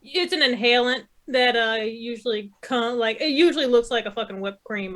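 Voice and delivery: usual speaking voice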